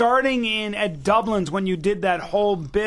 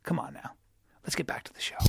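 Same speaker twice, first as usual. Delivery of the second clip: whispered; 12 dB quieter, faster than usual. Acted. Whispered transition after ad.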